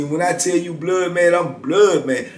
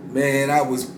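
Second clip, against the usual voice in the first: ordinary talk, nothing marked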